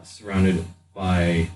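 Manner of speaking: ordinary delivery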